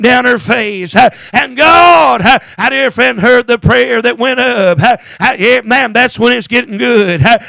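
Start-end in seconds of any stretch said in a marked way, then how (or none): none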